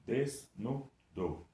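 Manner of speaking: Desnudo split slowly into its syllables; separating the syllables slowly for students. Shown as a demonstration. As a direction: break Desnudo apart, one beat per syllable. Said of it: "Vamos separar juntos?".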